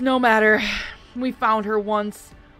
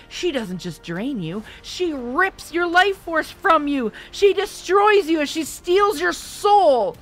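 slow, much higher and louder raging rant; furious tirade; 7 semitones higher, 8 dB louder than usual. A narrator's reading. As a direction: build a terrifying, furious lecture. Markham rages at Melissa.

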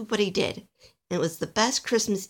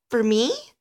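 higher, small-voiced disbelief; incredulous question; 5 semitones higher, 4 dB louder than usual. Alistair hearing of a special job, incredulous. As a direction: lift it in hopeful surprise. Small elf can't believe offer.

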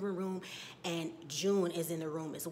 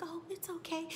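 soft, high imitated cooing; soothing a baby; 9 semitones higher, 6 dB quieter than usual. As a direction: comfort a baby gently, pitched up. Acting out Serena soothing baby.

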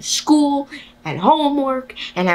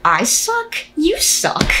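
higher, mocking comeback; childish retort; 8 semitones higher, much slower than usual. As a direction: toss the insult right back. Throwing insult back higher.

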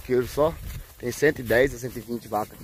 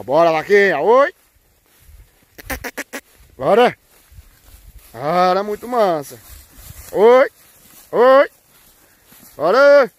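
high, loud herding yells; loud calls to push the herd along; cattle calls; 7 semitones higher, 9 dB louder, slower than usual. Real, not acted. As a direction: holler to drive the cows on. Driving the herd along the corridor.

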